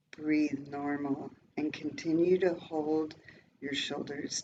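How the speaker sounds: soft, calm breathing guide; soft spa whisper. Acted. Leads a breathing exercise.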